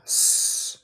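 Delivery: a long unvoiced "S" hiss like a snake; "S" as a plain hiss. Shown as a demonstration. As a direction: hiss out "S" alone. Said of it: "El otro es más como un zumbido".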